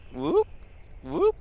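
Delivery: very high whoop whoop; whooping alarm. Acted. Alarm noise while backing away.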